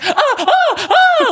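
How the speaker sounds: high, loud yelping ahs; a grown man's panicked yelp; squealing startled yelps rising in pitch; embarrassing yelp. Retold or imitated. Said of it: "that embarrassing scream when you".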